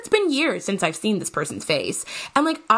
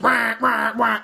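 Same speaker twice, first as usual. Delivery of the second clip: alarm wail; 5 semitones higher, 4 dB louder, slower than usual. Retold or imitated. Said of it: "it's like".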